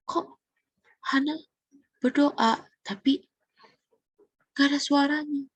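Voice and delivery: halting, mildly bewildered question; puzzled. Acted. Priest Eli watching Hana pray silently, acted confusion. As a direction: wonder aloud in broken-up pieces, puzzled. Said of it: "Dia bingung".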